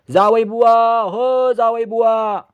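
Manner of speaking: calling out loudly across the yard, drawn out; long, slow hollering call. A narrator's reading. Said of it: "मोठमोठ्यांदा हाका मारायला".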